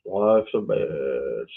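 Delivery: low, slow, drawn-out mock gloom; deep, dragging put-on voice. Repeats saying in put-on voice.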